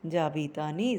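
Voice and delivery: settled answering tone on "Jabitanis."; normal answer pitch. Shown as a demonstration. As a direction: drop back down for "Jabitanis.". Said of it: "then it should be normal".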